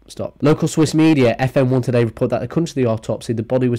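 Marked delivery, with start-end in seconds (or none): none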